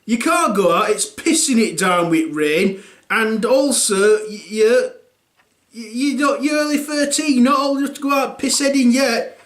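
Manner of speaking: high scolding rant; scolding parent. Retold or imitated. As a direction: tell him off. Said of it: "I'm like".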